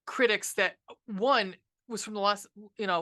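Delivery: ordinary talk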